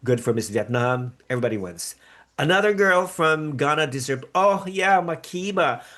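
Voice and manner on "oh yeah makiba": ordinary delivery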